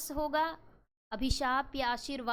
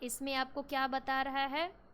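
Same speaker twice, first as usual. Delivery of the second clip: ordinary talk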